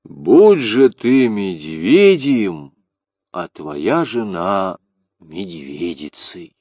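slow solemn tree's curse; talking tree. Acted. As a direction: pronounce the curse slowly, gravely. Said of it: "зашумело листьями и в ответ старику молвило".

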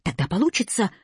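ordinary delivery, nothing marked

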